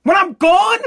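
firm shouted statement; forceful declaration. Real, not acted. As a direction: lay down the law.

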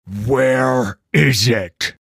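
loud, very slow, menacing demand; threatening interrogator's roar; furious demand. Acted. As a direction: draw out each word at full volume. Dagger at the farmer's throat.

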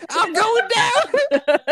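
high, slow, loud death cry; mock dying cry. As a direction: ham up going down from a stab wound. Play-acting being stabbed by wife.

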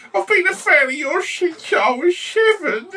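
high, slow gushing fan voice; starstruck fan. Pretend. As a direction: gush like a starstruck kid. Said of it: "You're like".

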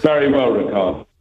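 echoey voice from a hallway. Real, not acted. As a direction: sound like you're down a long hallway. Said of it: "sounds like you're in a hallway at the moment".

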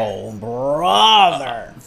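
slow, high, loud drawn-out holler; exaggerated cartoonish cry. Mocking the co-host's return, exaggerated slow holler. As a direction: stretch every word into a big theatrical wail. Co-hosts performing a mock exclamation.

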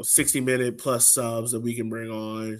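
usual speaking voice

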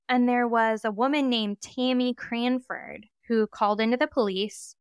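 ordinary talk, nothing marked

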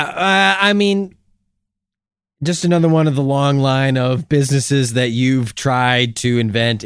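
ordinary talk

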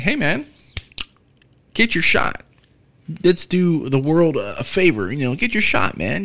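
lightly mocking, high on hey and get; mock celebrity PSA. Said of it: "people out here and be like".

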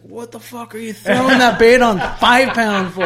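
Kelly finally gets riled, hammed up with a laugh; mock angry Kelly. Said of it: "So he's like".

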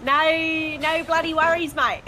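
hammed-up Aussie accent; broad Australian accent. Player mocks own slip, exaggerated Australian accent. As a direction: lay the Australian accent on thick. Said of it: "That was so Australian".

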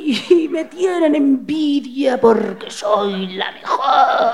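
theatrical wailing, slow and teary; hammed-up sobbing. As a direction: overact a tragic breakdown. Melodramatic fake breakdown. (llanto melodramático exagerado)

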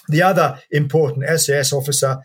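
ordinary delivery

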